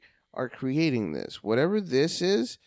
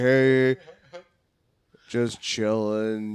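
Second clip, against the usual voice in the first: monotone; 3 semitones lower, 4 dB louder, much slower than usual. Retold or imitated. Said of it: "so fucking monotone".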